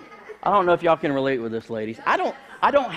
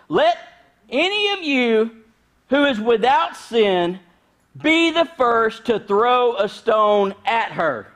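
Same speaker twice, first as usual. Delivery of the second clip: much higher and louder, slow and weighty; slow ringing pulpit declaration; solemn proclamation; 7 semitones higher, 5 dB louder, slower than usual. Acted. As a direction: deliver it like the verdict that ends the argument. Climactic quote of Jesus.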